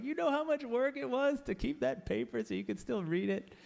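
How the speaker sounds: high, exasperated scolding; indignant ancient Egyptians. Retold or imitated. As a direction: scold like a fed-up Egyptian scribe. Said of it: "the Egyptians are like".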